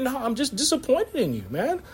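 a hushed, wounded parent; softly disappointed parent. Retold or imitated. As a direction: say it quietly, heavy with disappointment.

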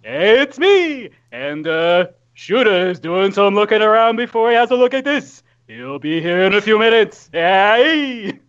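hammed-up, lively cartoon voice; cartoon bear character. Player introducing Chase's arrival, jolly cartoon-character voice. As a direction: swoop the pitch and call out the hey.

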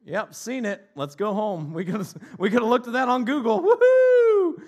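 loud, high, hammed-up sarcastic whoop; mock sarcastic cheer. Mocking bored date at the zoo, loud sarcastic cheer. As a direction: fake cheering to mock the exhibit.

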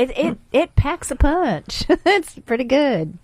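drawn out through a laugh. Real, not acted. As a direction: say it slowly and amused. Eggnog packs a punch.

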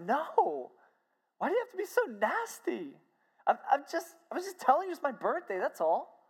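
high-pitched, defensive imitation of a woman; retold hurt protest. Retold hurt reply to nastiness.

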